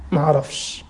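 lightly voiced excuse, low and soft; mock excuse. A preacher voicing a sinner's excuse, low and mumbling. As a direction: mumble the lame excuse like a guilty man. Mocking the excuse on Judgment Day.

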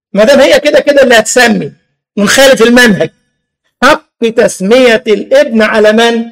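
ordinary delivery

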